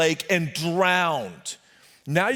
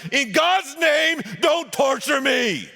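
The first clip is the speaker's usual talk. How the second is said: slow shouted plea, much higher; shouting demon; 9 semitones higher, slower than usual. Acted. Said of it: "He shouted at the top of his voice".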